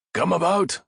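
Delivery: high, loud captain's shout over battle; a captain yelling to his crew; shouted order. Acted. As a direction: bellow the order across the deck. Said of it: "Raffe shouted".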